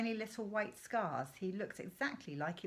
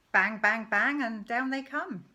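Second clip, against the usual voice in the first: louder punchy bangs; mock gunfire; 3 semitones higher, 10 dB louder than usual. Father mimicking the shooting to Danny, punchy and louder. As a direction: fire off each bang.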